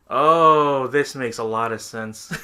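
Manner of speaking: loud drawn-out oh, then slow and settled; mock realization. Narrator reacting to the absurd rat story, mock realization. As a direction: open loud, then ease into slow irony.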